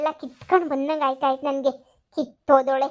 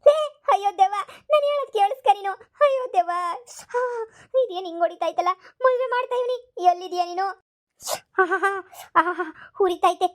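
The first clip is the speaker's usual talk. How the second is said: whimpering in pain; 3 semitones higher than usual. Acted. Grandmother cries under ghost's blows.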